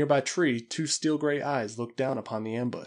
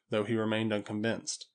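ordinary delivery

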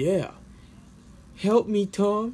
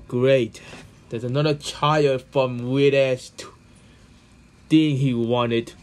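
ordinary talk, nothing marked

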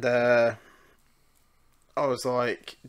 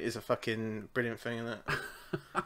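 quiet laughter; 9 dB quieter than usual. Real, not acted. Laughing at own drunk story.

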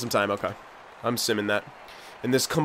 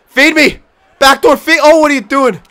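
high, loud calls for the pass; pleading yell; 9 semitones higher, 6 dB louder than usual. Real, not acted. Gamer begging a teammate for the puck, loud pleading yell. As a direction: shout at the AI to pass it.

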